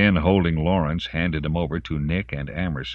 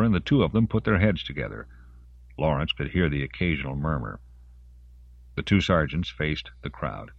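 plain reading voice

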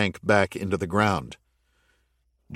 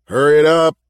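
raised, urgent call over the radio; 7 semitones higher, 9 dB louder, faster than usual. Acted. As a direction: hurry them along loudly. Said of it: "called Jake over the earpiece".

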